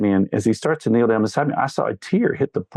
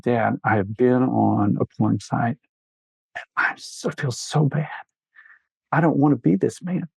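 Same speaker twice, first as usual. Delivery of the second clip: retold tearful confession. A father voicing his crying son confessing on the porch, hushed and tearful. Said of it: "crying heavily, sobbing".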